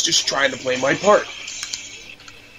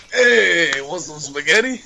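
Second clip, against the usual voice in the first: hammed-up Italian-American accent, pitched up on "Hey"; Italian from Boston; 3 semitones higher, slower than usual. Gamer clowning as an Italian from Boston for friend, mock accent. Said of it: "I sound like an Italian from fucking Boston".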